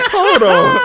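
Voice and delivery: high, loud "hold on"; mock alarm, voice leaping up; shocked objection. Husband finding his cereal box empty, shocked.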